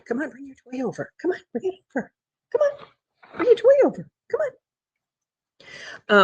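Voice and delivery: high-pitched pet-talk voice; sing-song high coaxing to a playful pet; coaxing the dog. Real, not acted. Owner urging the dog to fetch, high and coaxing.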